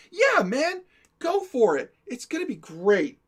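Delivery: high, loud, put-on enthusiasm; acted encouragement. [name] egging Adrian on in a prank, put-on enthusiasm. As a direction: egg him on with fake conviction. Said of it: "best acting I've ever seen out of [name]".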